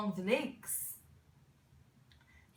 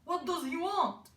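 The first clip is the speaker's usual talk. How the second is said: higher, emphatic questioning voice; raised, changed voice for the elders; elders' puzzled question; 6 semitones higher than usual. A narrator's reading. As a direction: ask it as the bewildered elders.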